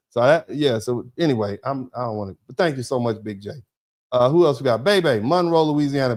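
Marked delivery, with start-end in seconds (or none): none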